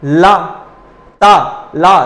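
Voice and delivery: ला and ता said separately so students feel where the tongue touches; try-it-yourself demo; sound demo. Shown as a demonstration. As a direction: contrast ला and ता aloud. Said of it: "बोल के देखिए".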